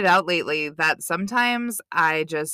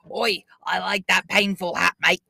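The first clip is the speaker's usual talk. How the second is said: mock cockney. Joining the mock cockney bit.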